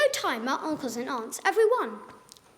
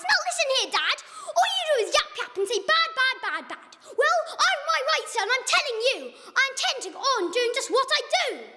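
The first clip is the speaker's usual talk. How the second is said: high, raised mad retort; mad yell; 8 semitones higher than usual. Acted. Once-ler yelling at the Lorax, raised and mad. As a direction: yell back at the nagging Lorax. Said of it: "I yelled to the Lorax".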